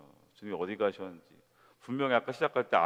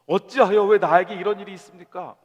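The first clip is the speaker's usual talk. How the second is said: 높고 크게 울부짖듯 따지며; 하나님께 억울함을 터뜨리듯; 원망하는 외침; 7 semitones higher, 10 dB louder than usual. Retold or imitated. (하나님께 원망을 쏟아내는 신자, 크게 울부짖는 항의)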